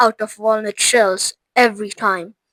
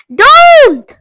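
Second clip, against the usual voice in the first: high, loud urgent don't; a quick alarmed shout; sharp cry; 11 dB louder than usual. Acted. Wonka tries to stop Veruca, alarmed shout. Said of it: "said Mister Wonka quickly".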